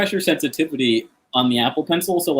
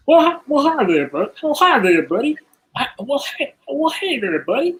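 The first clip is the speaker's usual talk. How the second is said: high-pitched friendly neighbor voice; neighbor accent; 13 semitones higher than usual. Acted. Neighbor inviting a buddy over for beer, high put-on voice. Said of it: "I'll do what I think a neighbor accent is".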